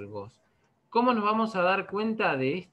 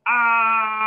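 loud, high, held yell of "Ah"; a long demo scream of "Ah"; long shout "Ah"; 4 semitones higher, 10 dB louder than usual. Shown as a demonstration. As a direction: shout "Ah" and keep holding it. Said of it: "puedo hacer un grito así".

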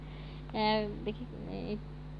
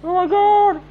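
shocked shout; 7 semitones higher, 13 dB louder than usual. Real, not acted. Shocked by the game.

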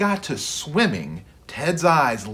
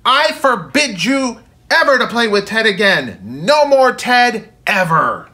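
much higher, much louder furious yell; furious shout; 9 semitones higher, 10 dB louder than usual. Acted. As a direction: explode at the boy, forbidding everything. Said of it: "Father was beside himself".

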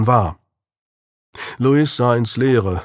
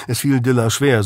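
ordinary delivery

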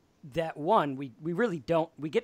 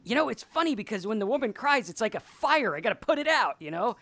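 higher, a touch louder, lightly voiced George; George explaining; 6 semitones higher, 3 dB louder than usual. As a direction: raise the pitch and explain it like a revelation. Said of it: "and he's like".

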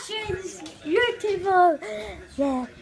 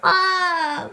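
ordinary talk, nothing marked

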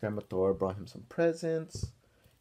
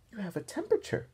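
lightly voiced school nurse, higher; a hint of a kindly nurse, pitched up; nurse's voice; 10 semitones higher, faster than usual. Nurse telling Arthur he has a fever, lightly voiced and higher.

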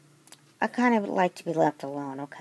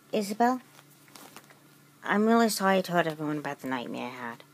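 usual speaking voice

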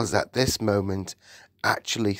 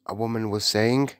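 plain reading voice

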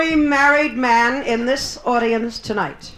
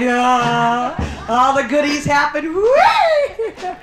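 mock delight; 2 semitones higher, 3 dB louder, much slower than usual. Comic playing the wild life with a mock squeal. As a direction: squeal like she's living it up.

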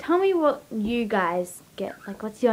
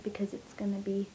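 whispering; 3 semitones lower, 11 dB quieter than usual. Real, not acted. Conspiratorial aside to viewers.

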